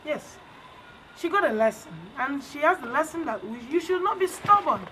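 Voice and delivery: ordinary delivery, nothing marked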